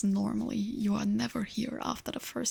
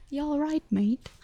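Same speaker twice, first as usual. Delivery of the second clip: higher, cheeky British mate greeting; louder, hammed-up British accent; mock British accent; 5 semitones higher, 5 dB louder than usual. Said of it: "stereotypical British accent".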